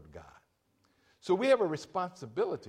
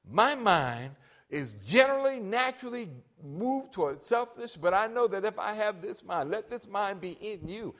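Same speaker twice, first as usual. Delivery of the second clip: lifted, pleading prayer, slightly higher; voiced prayer asking for Christ's mind; 7 semitones higher, 5 dB louder than usual. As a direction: pray it up toward God. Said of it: "when we are saying".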